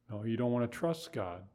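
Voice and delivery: normal talking voice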